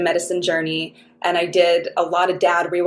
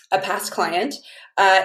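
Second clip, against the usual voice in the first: normal talking voice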